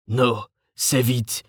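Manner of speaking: slow, choked rasp; strangled croak. A narrator's reading. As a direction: force words through a ruined throat. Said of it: "with a strangled croak".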